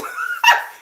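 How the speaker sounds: a real shrieking laugh, very high. Real, not acted. Laughing at her own joke to the chat, high squeal.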